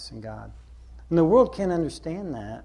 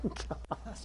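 exasperated sigh; 6 semitones higher, 11 dB quieter than usual. Retold or imitated. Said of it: "I'm like".

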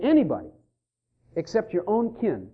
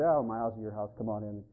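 jokey offhand invitation; 12 semitones lower, 11 dB quieter than usual. Retold or imitated.

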